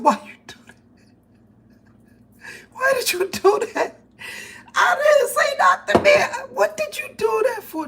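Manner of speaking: high, loud panicked shouting; shocked yelling. Retold or imitated. Girlfriend yelling at boyfriend after the punch, high and loud. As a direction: shriek at her boyfriend in alarm.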